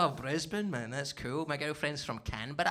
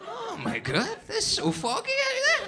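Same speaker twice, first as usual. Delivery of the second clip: much higher, laughing "foggy"; mock amazement; 4 dB louder than usual. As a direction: squeal it like fog is a miracle. Mock panic about the fog.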